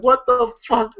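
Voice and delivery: ordinary delivery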